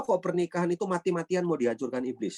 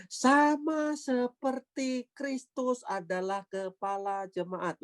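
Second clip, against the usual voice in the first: sama seperti drawn out slow and much higher; stress on sama seperti; 9 semitones higher, slower than usual. Shown as a demonstration. Preacher reading Ephesians to the congregation, stressing sama seperti slowly and high. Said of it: "lihat tekanan saya ini".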